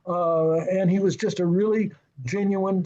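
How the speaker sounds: ordinary delivery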